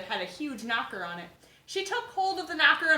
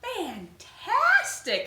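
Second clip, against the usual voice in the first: very slow, higher gushing praise; drawn-out praise; 8 semitones higher, 5 dB louder, much slower than usual. Acted. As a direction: gush over him slowly. Elizabeth praises the dragon.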